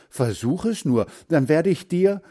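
mildly angry, rising threat; threatening. A narrator's reading. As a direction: warn him, pitch climbing. Threat in boys' quarrel. (drohend, mit steigender Stimme)

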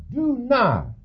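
God's high, sharp rebuke; stern rebuke. Retold or imitated. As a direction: call out "Du Narr" sharply from high up. God rebukes the rich man.

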